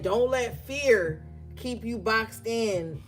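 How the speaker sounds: ordinary talk, nothing marked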